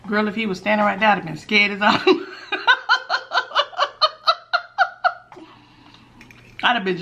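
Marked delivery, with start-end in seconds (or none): 2.0-5.4 long high-pitched laugh. Real, not acted. Laughing at her prank.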